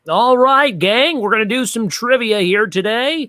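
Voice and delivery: high, loud, hammed-up game show host; rally the crowd in an over-the-top announcer voice; exaggerated host voice. Shown as a demonstration. Host showing how he'd open a corporate show, exaggerated host voice. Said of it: "But I talked like that".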